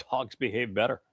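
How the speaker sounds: ordinary talk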